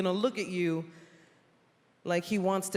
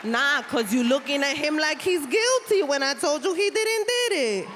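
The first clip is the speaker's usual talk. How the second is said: put-on higher, louder Puerto Rican accented lawyer; mock lawyer; 6 semitones higher, 4 dB louder than usual. Lawyer pleading to the judge in a thick Puerto Rican accent.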